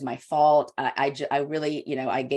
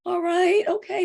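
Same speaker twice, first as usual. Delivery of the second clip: much higher, squeaky "all right, okay"; mock reluctant giving in; 5 dB louder than usual. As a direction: give in with a high, cornered squeak.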